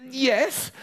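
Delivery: high-pitched little squeak; nervous boy squeaking high; squeaky high voice. Acted. Boy answers door to the neighbour, squeaky high voice. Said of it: "in a squeaky high voice".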